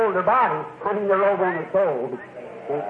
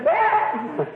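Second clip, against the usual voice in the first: goat bleat; 8 semitones higher than usual. Retold or imitated. Imitating goat cry, laughing.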